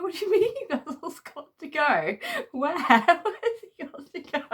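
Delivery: high, loud protest broken by laughs; laughing protest. Retold or imitated. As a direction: plead with dad, laughing through it. Teen pleading with Dad.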